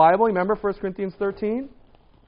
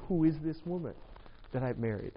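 softer and lower, sinking dread; dismayed hush; 4 semitones lower, 12 dB quieter than usual. Retold or imitated. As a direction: murmur it like a quiet regret. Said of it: "you'll be like".

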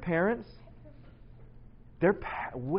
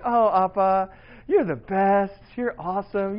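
put-on higher, louder gushing kid praise; imagined adoring kids; 5 semitones higher, 6 dB louder than usual. Dad imagines his kids praising him, mock adoring. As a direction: lightly play kids gushing over their dad. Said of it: "I was thinking, you know, they're like".

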